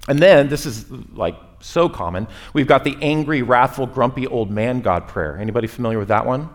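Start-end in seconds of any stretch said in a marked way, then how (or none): none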